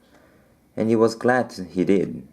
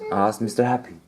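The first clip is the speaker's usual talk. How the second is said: plain reading voice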